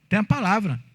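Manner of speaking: ordinary talk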